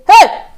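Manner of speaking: high, loud "hey"; sharp dramatic exclamation; panicked cry. Retold or imitated. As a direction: yelp out in mock alarm. Storyteller's panicked cry in retold scene.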